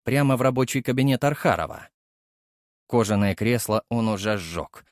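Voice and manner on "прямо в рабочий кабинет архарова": plain reading voice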